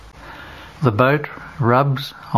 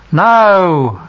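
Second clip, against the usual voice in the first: much higher, louder shouted no; loud reply; 7 semitones higher, 8 dB louder than usual. Acted. Fishermen shout back to the shore, loud. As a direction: shout it back across the water. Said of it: "replying in a loud voice".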